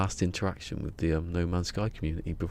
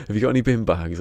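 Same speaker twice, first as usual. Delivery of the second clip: pretend phone call; 5 semitones higher, 7 dB louder, faster than usual. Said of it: "Like that".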